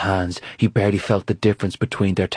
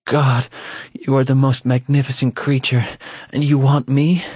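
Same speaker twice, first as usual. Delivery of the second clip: breathless awe; 5 semitones higher than usual. A narrator's reading. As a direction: gasp it out in wonder. Awed lover in sex scene.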